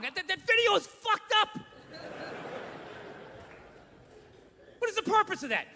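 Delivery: high, indignant outburst; exasperated rant. Retold or imitated. Husband ranting to his wife about the video, indignant. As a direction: protest it in outrage.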